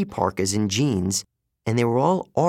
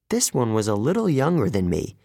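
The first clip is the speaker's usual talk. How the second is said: ordinary delivery, nothing marked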